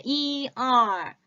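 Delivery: 이, 얼 drawn out very slowly; 이 and 얼 stretched, careful and very slow; teacher slowly models 이, 얼; slow correction demo. Shown as a demonstration. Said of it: "요 발음 다시 한번 교정해 드릴게요".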